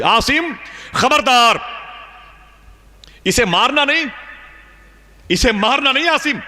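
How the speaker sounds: high, ringing voice from nowhere; mysterious warning voice. Retold or imitated. Unseen voice warning Asim not to kill his daughter, high ringing warning. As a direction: call out a sharp warning from beyond. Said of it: "اچانک میرے کان میں آواز آئی".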